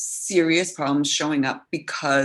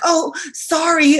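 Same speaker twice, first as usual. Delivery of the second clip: high, drawn-out mock sorry; stretched-out, high "sorry"; imitated apology; 11 semitones higher, 4 dB louder, slower than usual. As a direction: imitate a needless apology, pitched up and slowed. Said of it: "She was like".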